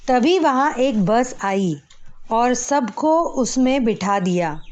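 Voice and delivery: usual speaking voice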